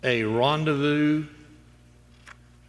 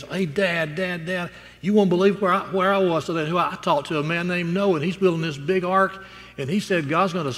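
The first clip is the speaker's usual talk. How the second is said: put-on higher-pitched, eager boy telling Dad; lightly voiced excited child; excited little boy; 5 semitones higher, 3 dB louder than usual. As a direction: blurt it out like a thrilled kid. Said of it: "you know, excited".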